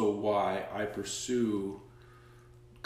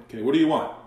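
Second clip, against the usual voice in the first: louder, higher, pointed question; aggressive demand; 5 semitones higher, 9 dB louder, much faster than usual. Retold or imitated. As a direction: press him hard for an answer. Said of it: "like, aggressively".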